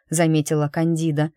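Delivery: ordinary delivery, nothing marked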